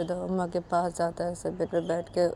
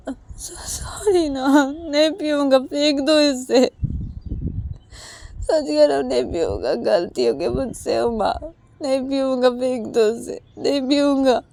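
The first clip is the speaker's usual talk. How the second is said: crying apology; 7 semitones higher, 7 dB louder than usual. Acted. Sanju, crying on the bed, begs Uma for forgiveness. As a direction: beg forgiveness through sobs. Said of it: "वो रो रहा था".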